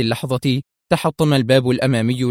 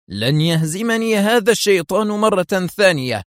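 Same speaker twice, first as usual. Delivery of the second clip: higher, hard vow; harsh; 8 semitones higher than usual. A narrator's reading. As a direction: grind out the vow. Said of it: "بلهجة قاسية". (لهجة قاسية يتوعد خصمه)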